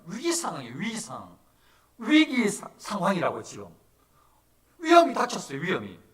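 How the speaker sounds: loud, high-pitched sermon alarm; urgent preaching. Real, not acted. Pastor warning of the disciples' crisis at sea, urgent preaching. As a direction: preach the danger at full force.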